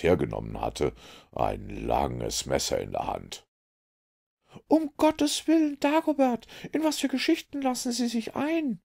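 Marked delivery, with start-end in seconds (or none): 4.7-8.8 high, loud female voice crying out in alarm; a lady gasping in fright; the lady horrified at Dagobert's gunplay, crying out high and scared; frightened cry. Acted. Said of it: "rief Frau Violette ganz bleich vor Schrecken".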